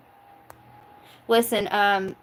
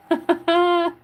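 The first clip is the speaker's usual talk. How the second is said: squealing laugh, pitched up and louder; high giggle; 9 semitones higher, 4 dB louder than usual. Real, not acted. Laughing at her own pun with a high, loud giggle. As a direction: let a high giggle burst out.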